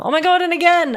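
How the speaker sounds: high, loud burst of delight; excited shout. Real, not acted. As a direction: shriek with joy at the gift.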